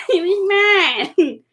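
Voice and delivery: slow, high mock wail; fake-sob over the stolen drink; mock crying. Twig mock-wailing that the drink was hers.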